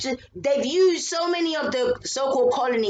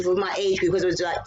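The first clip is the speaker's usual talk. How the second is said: ordinary talk, nothing marked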